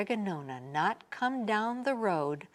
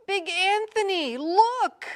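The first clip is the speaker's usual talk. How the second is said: high, loud excited cry; excited nun; 11 semitones higher, 5 dB louder than usual. A narrator's reading. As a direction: call out in alarm as a nun. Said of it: "a sister from the convent said".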